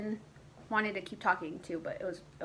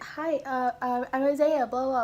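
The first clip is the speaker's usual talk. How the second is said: higher-pitched, hesitant introduction with uhs; lightly mocked stammering greeting, pitched up; shy, stuttering Isaiah; 4 semitones higher, 3 dB louder than usual. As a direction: imitate a nervous boy stumbling over hello. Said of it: "he was stuttering a little bit".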